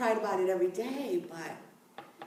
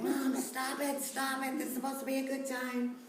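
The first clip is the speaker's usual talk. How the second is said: higher, softer, pleading son's voice; embarrassed son; 5 semitones higher, 5 dB quieter than usual. Retold or imitated. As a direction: hush your mother, embarrassed and higher.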